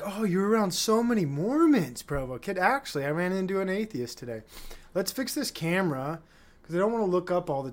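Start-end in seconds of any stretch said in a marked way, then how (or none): 0.0-2.6 mock people. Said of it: "people think".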